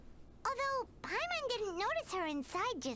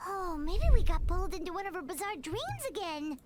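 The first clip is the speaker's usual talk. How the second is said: alarmed realization; 8 dB louder than usual. Acted. Paimon realizing they're in dream.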